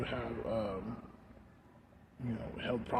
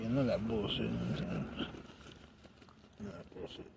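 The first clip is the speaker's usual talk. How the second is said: normal talking voice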